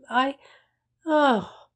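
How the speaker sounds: high, loud broken cry; hysterical outburst. A narrator's reading. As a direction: let it crack into hysterics. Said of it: "laughed loudly and burst into tears".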